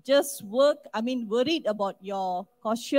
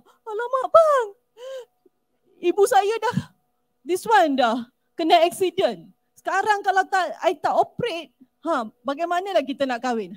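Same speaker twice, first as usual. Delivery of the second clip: much higher and louder, wailing mock sobs; fake crying plea; 6 semitones higher, 7 dB louder than usual. Love scammer begging her boyfriend, staged tearful wail. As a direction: put on a desperate crying act to get money.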